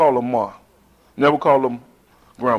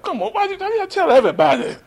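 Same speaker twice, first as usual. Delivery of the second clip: put-on young self protesting. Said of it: "I'm like".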